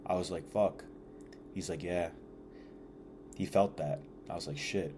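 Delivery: low, hushed recollection; subdued retelling. Retold or imitated. Telling how he broke down with his buddy, subdued. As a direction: let the voice sink softly, heavy with the memory.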